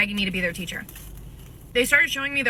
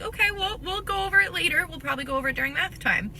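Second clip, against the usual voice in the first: high reassuring; 8 semitones higher than usual. Retold or imitated. Soothing the kids.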